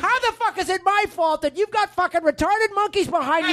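high, indignant rant; furious. Acted. Bandleader blaming band behind him, high furious rant.

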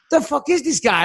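high, stunned disbelief; incredulous. Retold or imitated. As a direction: react like you can't believe it. His own retold disbelief.

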